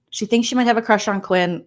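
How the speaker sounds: ordinary delivery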